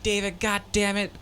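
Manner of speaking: high mock yell from the director; angry director. Director yelling at an extra, mock angry. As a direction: bark it like a fed-up director.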